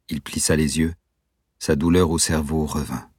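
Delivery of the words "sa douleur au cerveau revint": ordinary delivery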